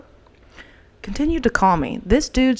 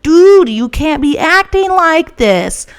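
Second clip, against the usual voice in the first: loud, higher and slow, scolding; exasperated yell; 7 semitones higher, 8 dB louder, slower than usual. Retold or imitated. To her armed boyfriend late at night, a slow loud scolding. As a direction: drag out each word at him, loud. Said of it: "I'm like".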